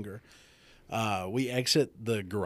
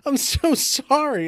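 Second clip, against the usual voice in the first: high, loud, drawn-out apology; imitating a frantic, sorry wife; panicked apologetic wife; 9 dB louder, slower than usual. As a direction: wail the apology in mock panic.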